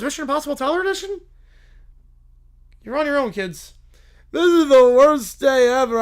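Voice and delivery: loud, high voices trading whines and retorts; annoyed grown-up and bratty kids. Acted. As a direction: play the whole squabble in the maze big. V-Dub and kids argue in maze.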